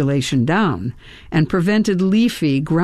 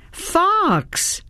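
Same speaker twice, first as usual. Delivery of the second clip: calling out; 13 semitones higher than usual. A narrator's reading. Calling Fox who will not stand, calling out. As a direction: call a name in a high, held note.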